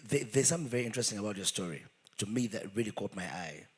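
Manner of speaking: ordinary talk, nothing marked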